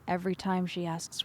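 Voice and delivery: ordinary delivery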